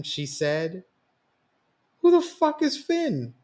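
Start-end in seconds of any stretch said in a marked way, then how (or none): 2.0-3.3 much higher, incredulous; can't believe the nickname; surprised friend. Acted.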